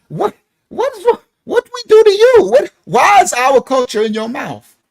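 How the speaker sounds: high, loud, offended Congolese protest; indignant outcry. Retold or imitated. Offended crowd protests a claim about them. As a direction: squeal in shocked offense. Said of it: "Everybody from the Congo be like".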